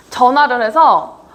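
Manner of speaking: ordinary talk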